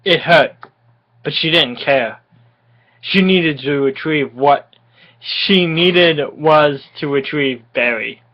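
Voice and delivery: ordinary talk, nothing marked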